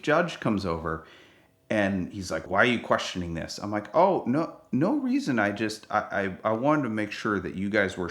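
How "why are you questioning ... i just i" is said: ordinary delivery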